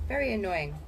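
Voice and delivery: ordinary delivery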